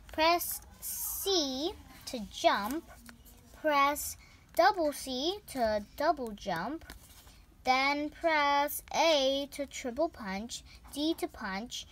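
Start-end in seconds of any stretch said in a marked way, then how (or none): 0.1-10.6 slow reading. Shown as a demonstration. Reads instructions slowly.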